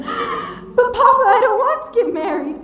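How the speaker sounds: high, loud, tearful begging; crying plea. Acted. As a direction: plead through sobs with her father. Bride pleading, heard crying.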